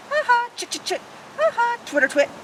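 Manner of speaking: high laughing twitter; playful high swallow chatter. Retold or imitated. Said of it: "Swallow slides from under a shingle".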